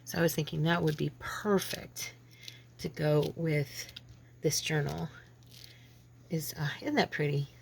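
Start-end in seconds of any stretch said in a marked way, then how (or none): none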